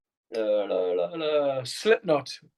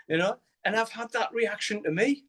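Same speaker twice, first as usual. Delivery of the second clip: ordinary talk, nothing marked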